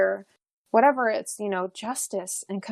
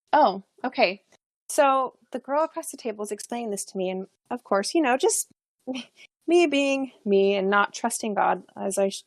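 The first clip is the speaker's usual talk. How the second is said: usual speaking voice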